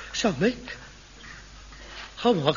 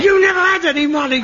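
angry accusation; 9 semitones higher, 5 dB louder, faster than usual. Acted. Son blames father in quarrel.

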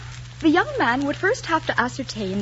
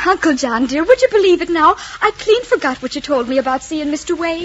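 higher, louder cajoling excuse; flustered excuse; 5 semitones higher, 8 dB louder than usual. Acted. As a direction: gush an innocent excuse when caught out. Caught out, making excuses to uncle.

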